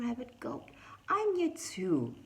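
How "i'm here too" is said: high cry. A narrator's reading. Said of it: "Owl cried".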